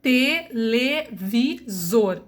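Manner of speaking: coletor spoken slowly, split co-le-tor; slow classroom syllabification; syllable by syllable. Shown as a demonstration. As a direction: spell out coletor by its sounds. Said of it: "eu tenho quatro vogais".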